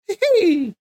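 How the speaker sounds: a quick cartoon plumber titter; Mario giggle. Vlogger imitating the classic Mario voice, high giggle. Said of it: "the original dude that does it the normal".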